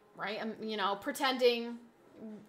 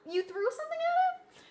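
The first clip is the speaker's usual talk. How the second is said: retold disbelief. Retells hearing son's confession.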